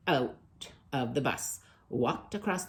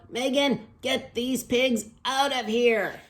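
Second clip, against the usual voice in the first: high, loud, slow angry order; a furious principal shouting across the school; principal yelling; 10 semitones higher, 6 dB louder, slower than usual. Acted. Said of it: "The principal yelled".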